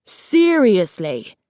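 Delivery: drawn-out, incredulous shout; indignant cry, first syllable held. A narrator's reading. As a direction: stretch it out in exasperated disbelief. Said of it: "Darkstalker shouted".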